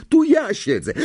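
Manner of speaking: high, loud protest; crying out. A narrator's reading. Harry protests that the seat is taken, crying out. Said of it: "krzyknął Harry".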